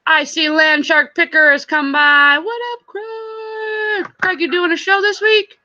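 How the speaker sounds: high, sing-song hail to a friend; real high-pitched excitement; excited calling out. Real, not acted. Calling out to arriving viewer.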